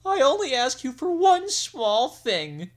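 queen whimpering at her husband. Acted. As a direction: whine like a wounded bride. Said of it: "whimpered she".

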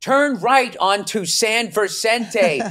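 high, slow robotic navigation voice; GPS voice impression. Host mocking the slow map voice, robotic. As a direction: drone on like a sat-nav. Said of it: "It's like".

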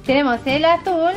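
normal talking voice

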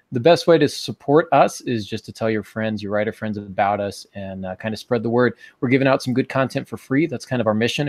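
usual speaking voice

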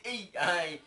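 loud startled "Ei, ai" then a quick quip; a jokey cry of alarm at the tick; excited exclamation. Retold or imitated. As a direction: exclaim it with a jolt. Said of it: "me ka me sɛ".

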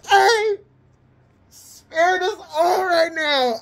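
high, loud, giddy outburst; squealing with delight; laughing. Real, not acted. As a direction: holler it through leftover laughter. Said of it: "let me stop laughing".

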